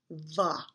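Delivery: voiced "va", lip against teeth. Shown as a demonstration. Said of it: "say the word va".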